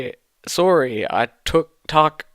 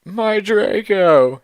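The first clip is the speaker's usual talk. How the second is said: higher, slow mock moan; 7 semitones higher, 4 dB louder, slower than usual. A narrator's reading. Vampire moaning for lost Draco, slow and hammed. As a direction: stretch it out in mock grief. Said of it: "he moaned".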